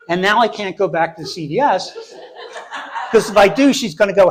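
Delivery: ordinary talk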